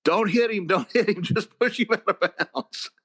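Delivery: high frantic sideline yell, laughing at the end; retold frantic yell. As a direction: holler it up high, half laughing at the memory. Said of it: "I'm screaming at Snedeker".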